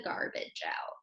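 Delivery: whispered ending, breathy and hushed; secret-telling whisper to a child. A narrator's reading. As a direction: drop to a whisper for the last words.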